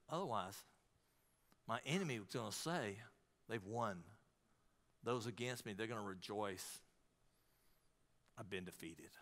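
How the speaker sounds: very soft, low and sinking; defeated. Acted. David telling God his enemies have won, hushed defeat. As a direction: let the voice drop away as if giving up. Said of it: "he feels like he's about to give up".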